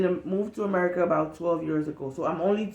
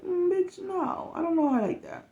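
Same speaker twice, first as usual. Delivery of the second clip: sassy retort; 11 semitones higher than usual. Retold or imitated. Retold sassy reply.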